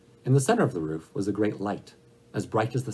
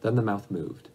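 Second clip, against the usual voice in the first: ordinary delivery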